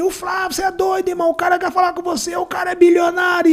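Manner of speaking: high excited mock shouting; imitated crowd yelling. Beach people pressing the water seller about Flávio, high imitated shouting. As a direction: shout it at him like the whole beach is buzzing.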